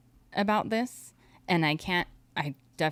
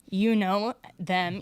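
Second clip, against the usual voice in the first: ordinary delivery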